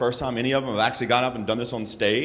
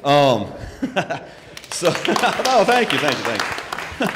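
laughing; 4 semitones higher, 4 dB louder, slower than usual. Real, not acted. Laughing thanks to silent room.

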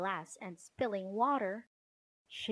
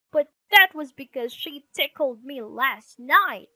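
loud, higher indignant retort; squabbling boy's raised voice; brother arguing back; 5 semitones higher, 13 dB louder than usual. Acted. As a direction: throw the blame straight back. Brother argues back in quarrel.

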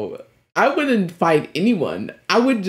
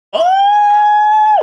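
excited shout; 8 dB louder than usual. Real, not acted. Hyped reaction to fire bending.

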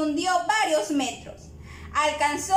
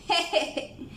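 short acted chuckle of bats; bats laughing. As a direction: give a little put-on "je, je, je". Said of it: "Rieron los murciélagos". (risita fingida de murciélagos)

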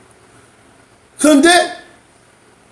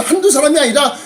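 ordinary talk, nothing marked

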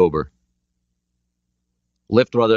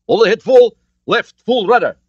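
urgent order; 8 dB louder than usual. Acted. Captain barks urgent orders.

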